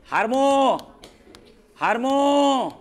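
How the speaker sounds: हार्मो drawn out, left for the class to finish; prompting. Shown as a demonstration. Teacher cueing the class to complete the term, prompting. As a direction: stretch हार्मो out as a cue, leaving off the ending.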